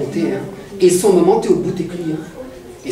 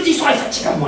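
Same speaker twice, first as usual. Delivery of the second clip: mildly angry king, pitched up; 5 semitones higher, much faster than usual. Acted. King fed up with the storyteller's tale, mildly angry. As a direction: act out a touch of royal temper, higher. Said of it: "commence à prendre la colère".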